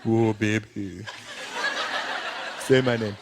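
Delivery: low, sultry lover's voice; murmured bedroom talk, pitched low; dealer making love. Acted.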